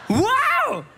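a high, loud wow of amazement; amazed wow. Acted. Preacher to congregation, a high amazed wow. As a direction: gasp out the wow like good news landed. Preacher exclaims at imagined promise.

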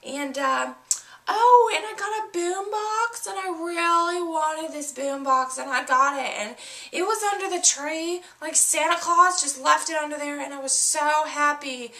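put-on high, gushing girlish voice with a gasp and laugh; excited little girl. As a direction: squeal like a thrilled kid showing off presents. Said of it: "And she's like".

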